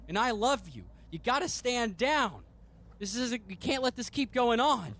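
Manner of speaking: high, earnest pleading son voice. Acted. Son begging his grieving father to let go, high pleading voice. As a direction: beg your father to stop, voice pitched high.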